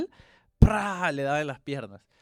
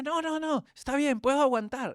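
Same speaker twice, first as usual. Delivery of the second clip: put-on stubborn child; 9 semitones higher than usual. Son insisting he can take it.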